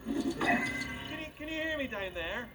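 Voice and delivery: high called-out question from afar; calling down a hallway. Acted. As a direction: call down into the shaft. Calling down the pipe.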